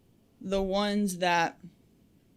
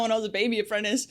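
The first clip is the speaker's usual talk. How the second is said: normal talking voice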